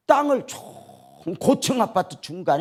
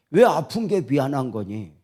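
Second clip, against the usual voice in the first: normal talking voice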